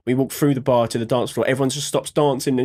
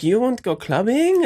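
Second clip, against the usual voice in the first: higher, slow coaxing invite; mock friend invite; 8 semitones higher than usual. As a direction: lure them out, drawn out and high. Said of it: "He was like".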